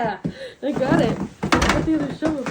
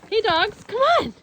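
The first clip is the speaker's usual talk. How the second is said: high, loud call to the dogs; 9 semitones higher, 4 dB louder, much slower than usual. Real, not acted. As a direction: call the dogs from across the yard. Calling the dogs over.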